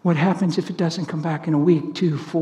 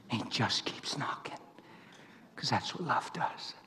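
whisper; 4 semitones lower, 14 dB quieter than usual. Real, not acted. Telling the church how love keeps knocking, hushed. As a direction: lower the voice to a hush. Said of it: "tender point about Jesus' love".

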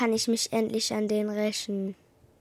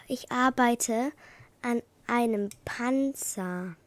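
ordinary talk

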